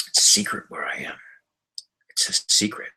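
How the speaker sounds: hushed playful whisper. Real, not acted. Telling viewers his location is secret, playful whisper.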